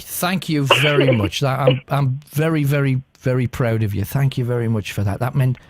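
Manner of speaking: thanking a caller who gave up the knife; tender thanks. Real, not acted. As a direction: tell her how proud you are, gently. Thanking caller after she complied.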